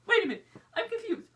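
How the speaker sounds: high-pitched, acted double take; hammed-up baffled protest; confused shock. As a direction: halt everything, voice jumping up. Acting out shock at Emma's look.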